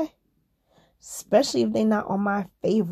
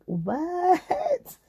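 high, stunned what; surprised; 10 semitones higher than usual. Real, not acted. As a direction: gasp out the word in disbelief. Shocked at nursing rates.

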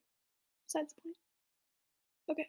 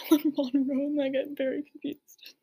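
louder, laughing through the words; laughing at a misreading; 6 dB louder than usual. Real, not acted. Admitting a silly misreading to a friend, laughing. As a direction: crack up at the mix-up.

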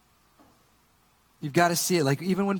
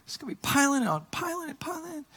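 fuming, retold; 11 semitones higher, 4 dB quieter than usual. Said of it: "I'm, like, fuming".

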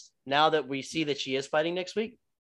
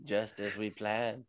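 a lightly voiced schemer, slow and low; slow villain gloat; 5 semitones lower, 6 dB quieter, slower than usual. Mock villain as Justine Kish gloats in her corner. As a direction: gloat slowly like a plotting villain. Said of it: "Justine Kish is there like".

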